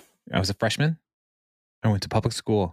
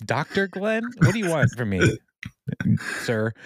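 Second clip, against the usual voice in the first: high-pitched, bewildered mock protest; flustered student; 6 semitones higher than usual. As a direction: play a thrown freshman, pitch climbing. Flustered student addressing informal professor.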